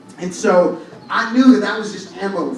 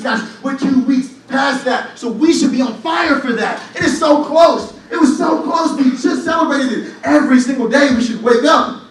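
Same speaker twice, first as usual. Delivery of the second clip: loud, high rallying preaching; full-force sermon shout; 5 semitones higher, 5 dB louder than usual. Acted. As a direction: fire up the congregation.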